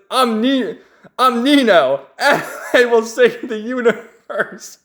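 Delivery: high, slow mock-hero announcement with laughter breaking in; hammed-up hero declaring himself, drawn out; heroic proclamation. Dramatic hero introduces himself in story.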